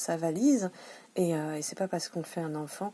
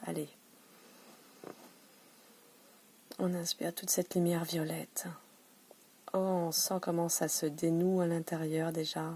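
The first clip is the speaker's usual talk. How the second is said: guided meditation. Acted. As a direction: guide the viewers to breathe in the violet light. Leads a breathing meditation.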